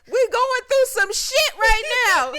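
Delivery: high, slow, hammed-up panicked mom. Mom telling the kids they're in trouble, slow hammed-up panic. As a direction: drag it out like a mom in the passenger seat. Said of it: "my mama was like".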